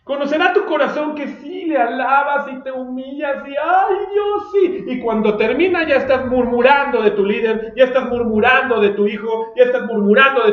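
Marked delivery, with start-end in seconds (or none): 3.5-4.9 high shouted fake praise; mock fervent worshipper. Preacher mocks a showy worshipper with high shouted praise. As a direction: ham up a showy churchgoer crying out to God.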